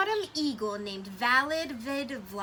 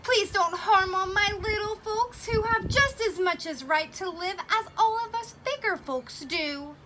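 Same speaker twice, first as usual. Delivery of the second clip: mild begging; 7 semitones higher than usual. Acted. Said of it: "and begged".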